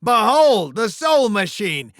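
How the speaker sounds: loud, emphatic showman's call; grand unveiling. Acted. Host unveiling the machine to contestants, loud proclamation. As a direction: proclaim it to the crowd.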